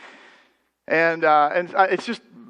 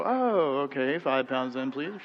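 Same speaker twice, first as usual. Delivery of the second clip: softer, flat "five pounds then, please"; deflated clerk; 4 semitones lower, 8 dB quieter, slower than usual. Retold or imitated. Till clerk cools off when he hears minister, fading softer. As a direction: clerk loses interest, voice dropping away. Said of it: "you'll see the face go".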